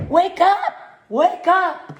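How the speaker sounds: high loud wake-up call; urgent shout. Acted. Ralph shaking sleepy Toodles awake, urgent shout. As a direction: rouse her urgently. Rooster waking Toodles before dawn.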